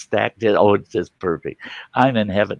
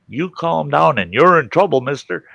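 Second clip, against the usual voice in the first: higher-pitched imitation of a mom scolding; retold yelling mother; 7 semitones higher, 4 dB louder than usual. Mother yelling at her two kids, scolding. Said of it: "my mother yelling at my sister".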